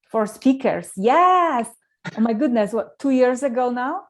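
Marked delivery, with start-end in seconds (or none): none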